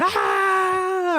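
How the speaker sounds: high sustained crowd-cheer scream; imitated crowd screaming. Host imitates conservative audience cheering Luke, high mock scream. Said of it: "They're all screaming and cheering".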